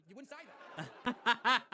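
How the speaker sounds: breaking into laughter as he lands it; laughing. Real, not acted. Comic lands the point to the audience, loud and laughing.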